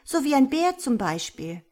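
high, loud and pointed; cross, insistent retort; annoyed. A narrator's reading. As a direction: press the objection irritably. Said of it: "unterbrach ihn das Mädchen ärgerlich".

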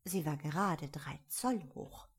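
plain reading voice

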